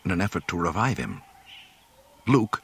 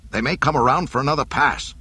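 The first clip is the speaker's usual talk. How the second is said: quick, loud, higher command; ordering; 6 semitones higher, 5 dB louder, faster than usual. A narrator's reading. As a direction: warn the officers urgently. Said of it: "Needa ordered".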